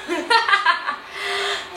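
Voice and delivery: staged, drawn-out chuckle; theatrical chuckle. Acted. Boastful performer after belittling a rumour, theatrical chuckle. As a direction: laugh smugly to yourself. Mocking laugh within the monologue.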